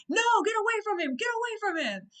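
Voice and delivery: high, fast, distraught mock yell; acted anguished shout. Retelling Hiccup pushing Toothless away, acted anguished shout.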